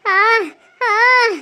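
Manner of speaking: short pained aah; pained groan. Acted. Woman in labor groans through a contraction.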